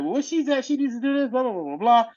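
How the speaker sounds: high, mocking chatter; mock council chatter. Mocking the council's chatter, high and dismissive. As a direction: parody them dismissively. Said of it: "they're all like".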